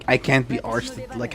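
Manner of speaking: usual speaking voice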